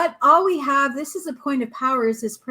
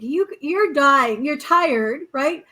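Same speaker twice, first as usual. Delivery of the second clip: ordinary talk, nothing marked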